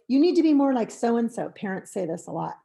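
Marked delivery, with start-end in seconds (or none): 0.1-1.5 louder, nagging comparison; a parent comparing the child to another kid; reproachful parent. Shown as a demonstration. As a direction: shame the child into behaving.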